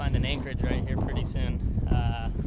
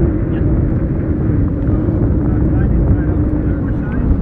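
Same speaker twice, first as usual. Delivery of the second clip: loud, very high shrieking laugh; burst of laughter; 12 dB louder than usual. Real, not acted. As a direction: crack up hard at your own joke. Friend teasing about the jeans.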